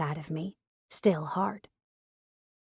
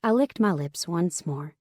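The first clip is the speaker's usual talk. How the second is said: ordinary delivery, nothing marked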